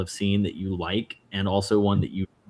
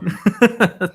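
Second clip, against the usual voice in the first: a quick, loud laugh; short high laugh; 11 semitones higher, 8 dB louder than usual. Real, not acted. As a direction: laugh it off lightly. Laughing at his own remark.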